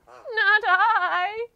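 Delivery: shaky sheep. Acted. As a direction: bleat it out shakily. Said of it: "in her shaky voice".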